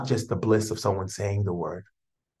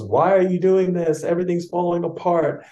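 much higher, louder panicked complaining; upset ego; 8 semitones higher, 6 dB louder than usual. Pretend. Frightened ego pleading with its owner, high and upset. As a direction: whine like a frightened ego losing everything. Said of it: "when the ego gets mad and goes".

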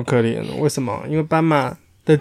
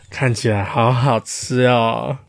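lower, slow greedy wolf voice; hungry big bad wolf; 4 semitones lower, slower than usual. Big bad wolf eyeing the sheep behind him, low and greedy. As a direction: eye the sheep like a tasty meal. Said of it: "大野狼回头看着后面的绵羊说".

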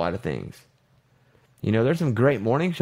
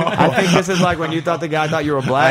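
laughing while talking; 4 semitones higher, 4 dB louder than usual. Real, not acted. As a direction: speak through real laughter, a bit louder. Teasing friend while laughing.

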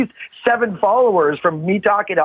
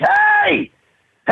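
very high, hammed-up calling out; pestering sun. The sun nagging a sleeper, high pestering call. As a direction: call out insistently, pitched way up. Voices the relentless sun waking him.

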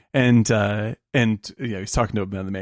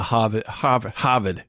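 Boston accent. Shown as a demonstration. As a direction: drop the R in Harvard the Boston way. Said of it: "dropping the R".

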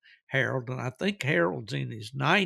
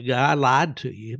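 ordinary delivery

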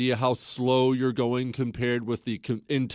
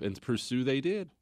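usual speaking voice

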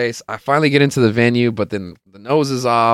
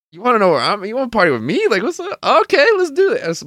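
mock excited; 12 semitones higher, faster than usual. Answering Kalechi's call about partying, climbing mock-excited voice. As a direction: pitch climbing much higher, hyped and eager. Eager reply to a friend's call.

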